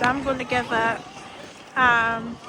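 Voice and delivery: normal talking voice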